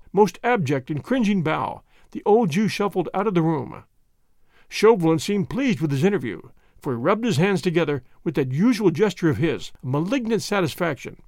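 ordinary delivery

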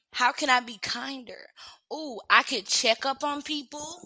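ordinary talk

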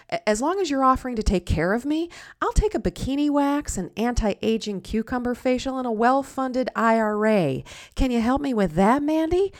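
loud, high comic tirade that drops at the end; mock demanding rant. Diner making demands of the server, hammed-up exasperation. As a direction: rattle off absurd demands, building in mock exasperation.

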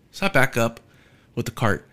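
ordinary delivery

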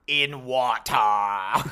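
very slow, loud, stretched-out imitation; film character impression. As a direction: drag each word out like the movie character. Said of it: "And he's like".

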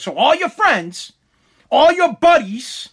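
imitated indignant scolding; pissed-off father. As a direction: lay it out like an angry dad, phrase by phrase. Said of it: "he was pissed".

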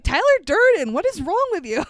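high, punched exasperated retort; mock exasperation. Marla fed up on the phone, mock exasperated outburst.

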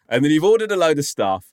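normal talking voice

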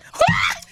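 high, loud shriek of laughter; real burst of laughter. Real, not acted. Storyteller cracks up at her own silly moral, loud laughter. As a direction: explode laughing.

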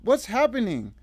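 high, loud distraught cry; sobbing demand. A narrator's reading. Revived Emily demanding answers, sobbing. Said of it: "sobbing so hard her chest".

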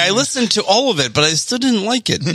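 high-pitched put-on Canadian apology; mock Canadian apology. Canadian listener rejecting the podcast, mock Canadian apology. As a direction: let them down in mock Canadian. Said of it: "the entire Canadian market's like".

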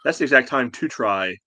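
ordinary talk

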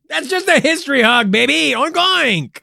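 high, loud hyped-up cry; excited hog introduction. Acted. Excitedly presenting the hog mascot.

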